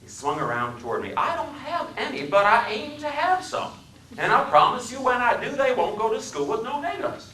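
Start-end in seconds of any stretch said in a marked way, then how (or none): 1.2-7.3 much higher, louder Southern vow; heated Southern accent. Acted. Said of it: "His speech was the deepest South".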